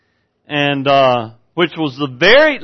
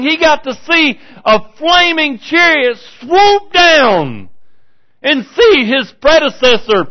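rising, louder revival preaching; fiery preaching; 5 semitones higher, 4 dB louder than usual. Acted. Preacher stirring his congregation, in full fiery preaching. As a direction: preach it to the rafters.

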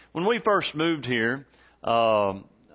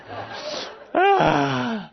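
mock sob; 13 semitones higher than usual. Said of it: "she was crying".

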